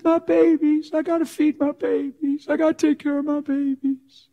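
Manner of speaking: high, pleading voice of a weeping mother; crying woman. Retold or imitated. Prostitute explaining why she works, pleading in high crying tones. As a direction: plead like a mother in tears over her kids. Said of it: "She cries".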